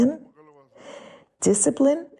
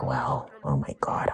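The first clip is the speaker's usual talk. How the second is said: startled unvoiced whisper, much softer; 7 semitones lower, 8 dB quieter than usual. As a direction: mutter it to yourself in dismay. Mock overwhelmed parent inner voice.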